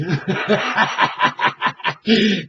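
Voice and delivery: a long rolling laugh; hearty laugh. Real, not acted. Host laughing after quoting Okie's motto, hearty laugh. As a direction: laugh it out freely.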